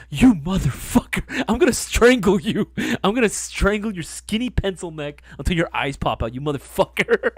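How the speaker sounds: hammed-up rage at a friend who spoiled it; mock furious threat. Telling off the friend who ruined the surprise, mock furious.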